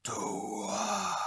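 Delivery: soft, crying ghostly call; a faint wail from somewhere unseen; eerie whisper. Acted. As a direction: let the name drift out of the dark. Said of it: "heard that whisper".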